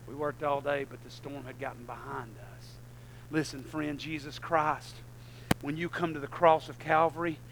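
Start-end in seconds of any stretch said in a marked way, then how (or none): none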